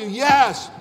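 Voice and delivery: ordinary talk, nothing marked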